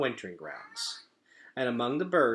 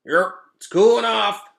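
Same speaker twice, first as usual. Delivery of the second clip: high, loud croaky frog voice; croaking frog; 11 semitones higher, 15 dB louder than usual. Acted. Frog croaks to another frog, high and loud. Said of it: "croaked one frog to another".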